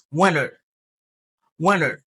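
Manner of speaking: "Winter" said as "WIN-er", no T; American pronunciation. Shown as a demonstration. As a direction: drop the T in "Winter", falling tune. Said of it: "li repete se winter". (di "Winter" san T, alameriken)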